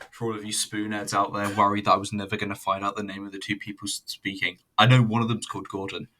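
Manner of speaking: ordinary talk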